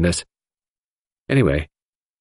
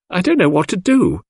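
on the verge of tears; 10 semitones higher than usual. A narrator's reading. Cynthia breaking down as she tells Hastings, close to tears. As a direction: let the distress spill over before crying. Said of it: "burst out crying".

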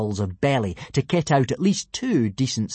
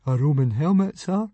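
sheepish admission; 6 semitones higher than usual. A narrator's reading. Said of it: "admitted Fishlegs".

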